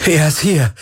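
high, elated cry; jubilant. A narrator's reading. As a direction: cheer the good news out. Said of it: "jubelte Galen".

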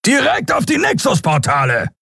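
high, urgent captain's roar. A narrator's reading. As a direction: bellow it across the bridge. Captain shouts on bridge. (Kapitän brüllt zur Brücke, hoch und drängend)

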